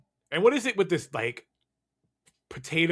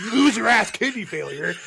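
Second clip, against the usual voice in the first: laughing insult; 9 semitones higher, 7 dB louder than usual. Real, not acted. Mock insult while laughing.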